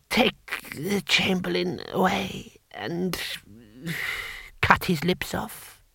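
put-on high, slow, soft old ruler; halting, feeble decree with long gaps; ancient emperor. Emperor ordering guards, high slow feeble voice.

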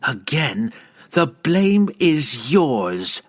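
slow, drawn-out snarl. Acted. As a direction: snarl the blame at him. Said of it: "Verna snarled".

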